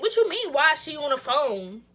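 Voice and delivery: high, loud, incredulous echo; indignant retort. Retold or imitated. Girlfriend throwing her man's question back at him, indignant.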